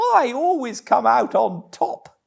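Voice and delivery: high, loud, cocky little Toad voice; Toad talking to himself. Acted.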